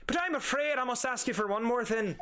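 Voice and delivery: high-pitched Irish villain; Irish accent. Acted. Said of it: "I'm just gonna do Irish".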